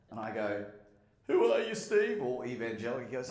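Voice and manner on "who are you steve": high and loud, matching his boom; loud mock question. [name] playing along with Steve, loud and high. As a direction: call it out in the same big voice.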